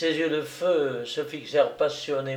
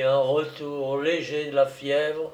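plain reading voice

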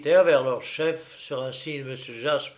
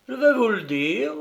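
coldly; 9 semitones higher, 5 dB louder than usual. A narrator's reading. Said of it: "fit froidement le prince".

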